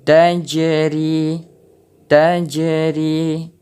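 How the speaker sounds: "tajri" with the j bouncing; qalqalah on jim. Shown as a demonstration. Teacher demonstrating qalqalah, bounced jim. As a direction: let the jim in "tajri" rebound. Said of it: "Kita dengar suara pantulan".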